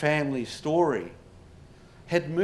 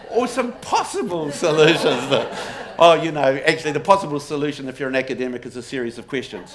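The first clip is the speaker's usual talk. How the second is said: laughing through the words, louder; giggling while owning up to being an academic; 5 dB louder, faster than usual. Real, not acted. Academic laughing at himself before the audience.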